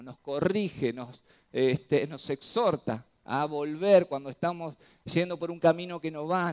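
ordinary talk

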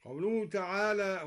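ordinary talk

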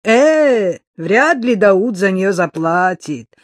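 slow, insolent drawl. A narrator's reading. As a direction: stretch the words out insolently. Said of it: "протянул араб с наглой растяжкой в голосе".

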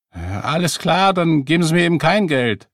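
high, loud, offended retort; huffy. A narrator's reading. Beggar refuses further questioning over money, huffy.

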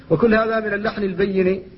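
ordinary talk, nothing marked

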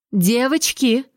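mildly indignant mother; indignant exclamation. A narrator's reading. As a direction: call the girls to order, shocked. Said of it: "Возмущённый возглас старшей баронессы".

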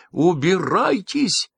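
high, slow shriek; a piercing high command. A narrator's reading. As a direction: screech them out of the room. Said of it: "завизжала она".

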